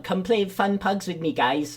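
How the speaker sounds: high-pitched mock Swedish accent; little Swedish voice. Podcaster mocks Swedish teammate begging for games, little Swedish voice. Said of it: "in his fucking little Swedish voice".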